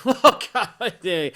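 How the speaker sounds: high, loud outburst through a laugh; mock gushing reaction. Co-host sends up a reaction to the clip, mock gushing.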